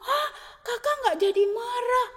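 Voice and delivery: high, breathy startled question; high surprised question with a catch in it; surprised gasp. Acted. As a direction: gasp it out in disbelief. Jacob surprised brother not angry.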